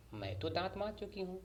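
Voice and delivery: evasive mother. A narrator's reading. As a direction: wriggle out of it like a caught parent. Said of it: "अम्मी ने टालना चाहा।".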